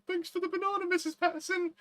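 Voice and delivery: a high mock-polite teenager; cheeky teen. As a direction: pipe it up sweetly like a smug teen. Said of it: "He's like".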